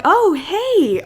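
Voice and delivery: high, swooping, overly casual hello; sing-song it to cover the awkwardness; awkward greeting. Retold or imitated. Retelling how she greeted her crying date, awkwardly breezy.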